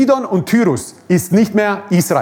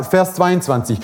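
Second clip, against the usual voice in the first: ordinary talk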